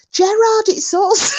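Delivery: high, loud call of his name; shouting out again. Retold or imitated. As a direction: holler at him like a fan.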